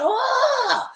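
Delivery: high, loud wail; anguished cry. Retold or imitated. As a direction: cry out in pain. He breaks down yelling.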